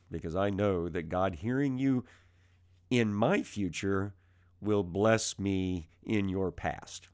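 ordinary talk, nothing marked

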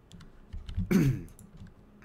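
loud rough throat clear. Real, not acted. Youtuber with a cold clears his throat mid-video. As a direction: clear a sick throat hard. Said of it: "forgive me if my voice sounds a little different or if I cough".

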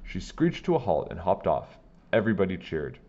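plain reading voice

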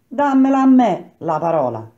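high, loud bid to speak; calling out. A narrator's reading. Worker demands the floor at the meeting, calling out loudly. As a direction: insist loudly over the meeting.

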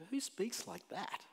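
mock the whole idea, incredulous; incredulous scoff. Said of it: "we look at this and go".

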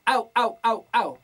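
loud sealed bright au calls; compressed au. Shown as a demonstration. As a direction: seal the glottis and punch it. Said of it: "con puro". (au comprimido y sellado)

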